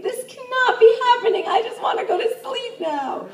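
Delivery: a comic plea to the universe; mock despair. Despairing thought over gum.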